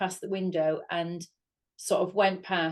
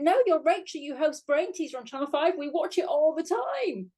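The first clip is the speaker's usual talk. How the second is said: higher-pitched, insistent enthusiasm; imitated eager fan; 7 semitones higher than usual. Fan recognises the presenter at last, excited imitation. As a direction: mimic a keen fan, drawing out the ending.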